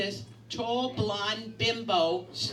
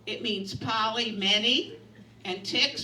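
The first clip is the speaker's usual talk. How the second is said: ordinary talk, nothing marked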